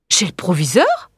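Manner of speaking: taken aback. Acted. Pupil learns she must see the headmaster, taken aback. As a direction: echo it as a worried question.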